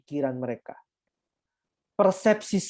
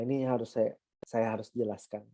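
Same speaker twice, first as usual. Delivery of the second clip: normal talking voice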